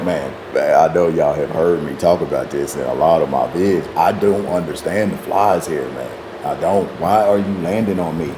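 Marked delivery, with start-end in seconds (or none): none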